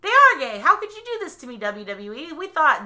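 high, loud mock outrage; betrayed fan. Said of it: "you're just like".

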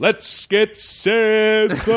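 high, long-drawn fight announcer call; hammed-up announcer's singsong holler; mock ring announcer. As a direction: stretch every word like a boxing MC. Parodies the ring announcer's famous call.

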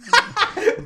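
high squealing laugh; sudden high laugh; high burst of laughter. Real, not acted. Laughing at name remark.

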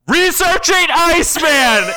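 high, slow, booming announcement; hammed-up computer blurting it out loudly; blaring computer voice. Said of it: "the computer goes".

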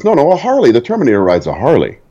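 high-pitched insistent "no, no", then settling; emphatic retold correction. Director setting stuntman straight about the bike, imitated insistence. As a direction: correct him as if it's obvious.

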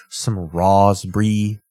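slow, lightly put-on British accent; mock British accent. Host mocking the TV chef, slow mock British accent. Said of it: "just 'cause it's a British accent".

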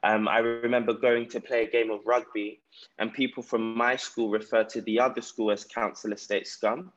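ordinary talk

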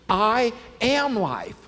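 slow, emphatic proclamation; bold, weighty claim. Retold or imitated. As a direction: declare it word by word, starting loud. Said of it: "This is bold".

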